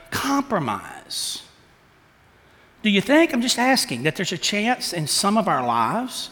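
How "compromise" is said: quiet emphatic. Real, not acted. Preacher drives home point.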